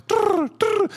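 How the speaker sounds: high trilled dove coo. Retold or imitated. Mimicking a hovering dove for the congregation, high trilled coo. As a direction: flutter out a mock bird trill. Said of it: "Holy Spirit hovering, like".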